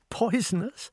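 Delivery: a refined lady amused by the notion; incredulous echo. A narrator's reading. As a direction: scoff lightly at the idea. Incredulous echo of stranger's word.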